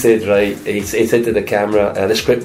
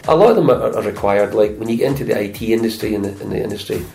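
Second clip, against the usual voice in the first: ordinary talk, nothing marked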